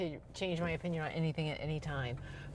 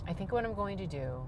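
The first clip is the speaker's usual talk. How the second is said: calmer, softer restart of the line; talking lower; 6 dB quieter than usual. Shown as a demonstration. Calming herself down for the camera, speaking softer. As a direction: bring it down, calm and lower. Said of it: "talk, talk lower".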